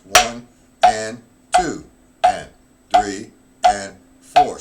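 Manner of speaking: loud, slow, even counting aloud. Shown as a demonstration. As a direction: count each beat out like a drum teacher. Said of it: "two doubles and a two singles".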